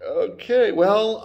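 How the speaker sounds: high, hesitant mock okay; retold uncertain okay. Mando waits on the idle child, high and unsure. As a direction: draw it up, at a loss.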